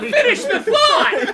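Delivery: slow, loud, laughing plea to finish; laughing demand. Real, not acted. Friend begging the reader to finish the thought, loud and laughing. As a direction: drag it out, begging through laughter.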